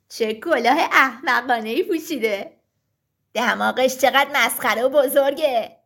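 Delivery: put-on taunting voice, swooping up and down; mocking voices. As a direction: jeer at someone's looks. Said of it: "شروع کردن به مسخره کردنش". (با لحن تمسخرآمیز)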